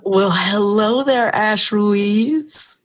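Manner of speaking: slow, buttery, velvety greeting; velvet voice. Acted. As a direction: drawl it out smooth and rich. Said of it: "your buttery, velvety voice".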